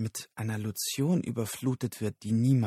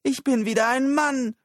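very high, loud whoop of joy; jubilant shout; 6 dB louder than usual. Acted. Tony celebrates being a man again, jubilant. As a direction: cry it out high and triumphant.

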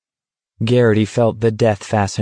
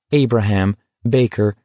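ordinary delivery

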